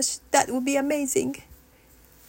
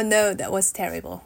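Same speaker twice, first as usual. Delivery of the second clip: ordinary delivery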